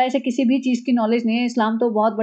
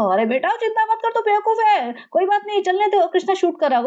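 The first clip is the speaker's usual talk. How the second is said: higher, coaxing; mock soothing husband; 6 semitones higher than usual. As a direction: pat her down like a child. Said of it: "Sunny उसको संभाल रहा है". (बच्चे को बहलाने वाला लहजा)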